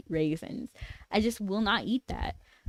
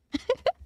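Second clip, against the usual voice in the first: short high giggle; high laugh. Real, not acted. Streamer giggles about a favourite character, high. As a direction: giggle briefly.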